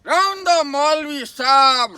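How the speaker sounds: loud, slow, drawn-out stage call; loud protest. Acted. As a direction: call out to the cleric, stretching the words. Shepherd cuts off the cleric. (ज़ोर से, खींचकर टोकना)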